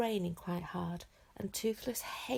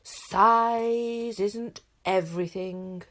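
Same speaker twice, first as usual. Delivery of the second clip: huge slow booming chief voice; 3 semitones higher, 13 dB louder, much slower than usual. A narrator's reading. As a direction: thunder it across the beach. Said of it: "boomed Stoic so loudly".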